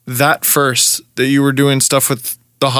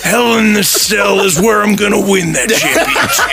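raised wrestling promo voice; Macho Man; 4 semitones higher than usual. Acted. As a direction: vow victory like a pro wrestler. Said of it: "Gotta work on my Macho Man".

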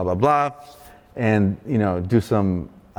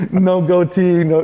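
louder, higher dismayed cry; staring in the mirror, a high loud groan of dismay; 7 semitones higher, 5 dB louder, slower than usual. Retold or imitated. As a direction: wail at the bare chin in the mirror. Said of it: "I'm like".

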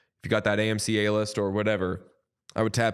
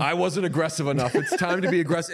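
put-on hyped kid; 6 semitones higher, faster than usual. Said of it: "being like".

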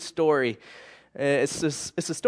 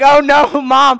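higher, louder little-boy wail, hammed-up; mock tearful plea; 9 semitones higher, 8 dB louder, slower than usual. Little boy confessing to his mom, hammed-up tearful cry. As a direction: play the crying kid caught by Mom. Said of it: "It's like".

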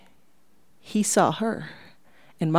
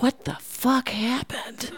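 shocked whisper; 7 semitones higher, 5 dB quieter, slower than usual. Retold or imitated. Inner shock at mother's past.

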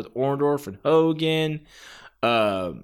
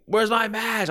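complaining Terry Funk; 9 semitones higher than usual. Retold or imitated. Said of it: "Terry Funk would pop up complaining".

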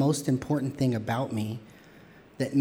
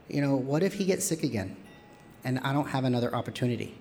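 ordinary talk, nothing marked

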